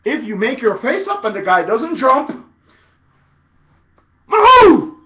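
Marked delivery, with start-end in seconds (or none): 4.3-5.0 very high, very loud silly cry; funny shout. Shown as a demonstration. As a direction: make any funny loud noise. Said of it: "Just say it".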